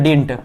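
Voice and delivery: didn't said as one syllable, "dint"; contracted didn't. Shown as a demonstration. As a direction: run didn't together as "dint", dropping the middle d. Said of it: "हम लोग didn't पढ़ते हैं".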